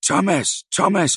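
high, loud repeated calls; calling out. A narrator's reading. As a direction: holler the name twice. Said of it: "lød det".

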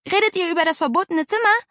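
high, quick eager question; breathless child. A narrator's reading. Girl bursting in on the adults' talk, breathless. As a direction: blurt it out as if just run in. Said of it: "Atemlos plumpste Paula auf einen Stuhl".

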